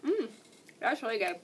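talking between bites; chewing while talking. Real, not acted. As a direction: mouth partly full of candy. Seen 0: Puts candy in mouth at 1.2, then keeps chewing while talking.

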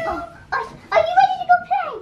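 high, fast excited squeal; squealing with excitement. Real, not acted. Excited girl greeting puppy.

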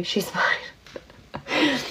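giggling in a whisper; laughing whisper. Real, not acted.

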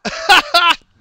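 loud high-pitched laugh; burst of laughter. Real, not acted. Laughs at failed contraption.

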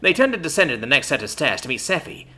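ordinary delivery, nothing marked

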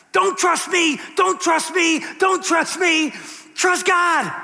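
high, loud pleading shout; screaming dollar bill. Pretend. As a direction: yell it like the bill is begging. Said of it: "the dollar bill is screaming at us".

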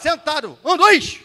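high, very loud sit-down order; shouted drill command. Shown as a demonstration. Instructor makes the class sit with energy, shouted drill command. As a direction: bark the count at a platoon. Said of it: "Vamos lá mais uma vez".

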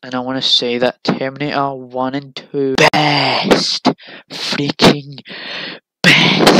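loud, gushing about Terminator; excited. Real, not acted. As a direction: build to a near-shout on best. Raving about favourite films.

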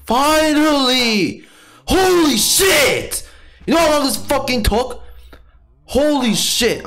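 very high, loud, drawn-out outburst; real exasperated yell. Real, not acted. Gamer finally getting mending after ages, high exasperated shouting. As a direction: let it burst out as a high, stretched shout.

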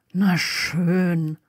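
understated, grudging consent; mildly unwilling mumble; reluctant grumble. A narrator's reading. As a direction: grumble as you give in. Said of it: "brummte Harry widerwillig".

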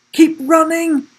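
high-pitched, loud shout to flee; urgent urging. A narrator's reading. Sam galloping up beside Will, urgent shout. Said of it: "urged Sam".

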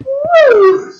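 high loud whoop; excited cheer. Real, not acted. Cheering in the game.

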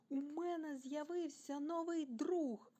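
high, slowly stretched happy announcement; joyful exclamation. A narrator's reading. Timmy announces his new friend, joyfully exclaiming. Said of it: "радісно вигукнув Тімі".

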